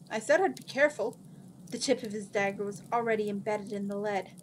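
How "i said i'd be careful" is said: quick, higher wounded protest; a touch of offended defensiveness; rather hurt. A narrator's reading. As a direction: protest fast, slightly hurt. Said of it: "sounding rather hurt".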